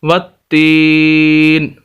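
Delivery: वत्तीन with the ई drawn out long; वत्तीन with a long madd on ई; stretched vowel. Shown as a demonstration. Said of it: "हम यहाँ पर इसको खींच रहे हैं".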